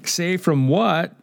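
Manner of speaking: high, slow, savouring "what"; amused emphatic repeat. Retold or imitated. Preacher repeating Sproul's comeback to the congregation, slow and amused. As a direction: relish the punchline as a mock challenge.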